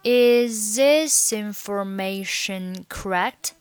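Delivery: slow, drawn-out linked question; slow demo. Shown as a demonstration. Teacher modelling the linked question for learners, slowly. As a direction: stretch each syllable, keeping the words joined.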